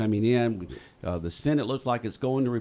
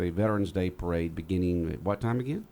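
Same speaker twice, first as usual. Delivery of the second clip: ordinary delivery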